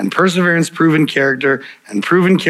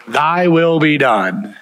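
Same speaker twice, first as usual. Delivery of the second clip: each word held long and slow; slow solemn "Thy will be done"; slow, drawn-out prayer; 4 semitones higher, much slower than usual. Shown as a demonstration. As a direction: pray it slowly, weighing every word. Prayer quoted slowly.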